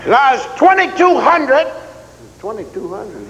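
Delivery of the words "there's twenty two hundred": sergeant's bark. Retold or imitated. Drill sergeant barking time.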